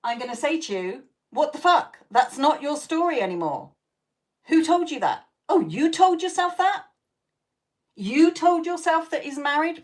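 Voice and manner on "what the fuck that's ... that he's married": higher, louder tough-love telling-off; mock scolding. Imagined friend scolding a client who moans about her married man, loud and high.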